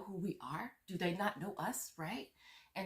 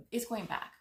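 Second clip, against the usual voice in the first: usual speaking voice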